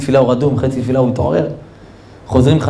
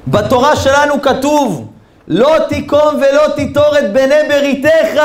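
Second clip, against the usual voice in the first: slow, high, ringing declamation; high drawn-out shout of scripture; emphatic proclamation; 11 semitones higher, slower than usual. Retold or imitated. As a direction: proclaim the verse word by word at full voice. Host proclaims a Torah verse.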